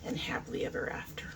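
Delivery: soft breathy whisper of the box words. Real, not acted. Whispering the box's fairy tale words. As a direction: whisper it like a secret. Reading the box text aloud.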